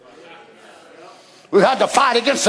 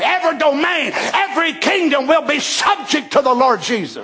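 fiery preaching; 4 semitones higher than usual. Real, not acted. Preacher shouting to the congregation, fiery.

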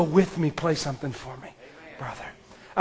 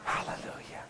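whispered hallelujah; 19 dB quieter than usual. Real, not acted. Quiet worship after sermon point.

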